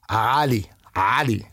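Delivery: Ali with a guttural Ain at the start, Arabic style. Shown as a demonstration. Tells the host how Arabs say his name, guttural Ain. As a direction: say Ali from the throat as in the Middle East. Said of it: "do you know how to pronounce the actual name".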